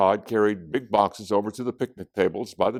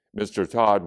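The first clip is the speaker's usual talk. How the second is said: ordinary delivery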